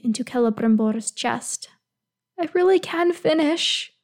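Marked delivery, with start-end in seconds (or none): 2.4-3.9 higher, small tearful voice; a hint of hiccuping through tears. A narrator's reading. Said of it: "he hiccups, voice small".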